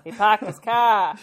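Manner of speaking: put-on Boston accent. Joke Boston accent bit.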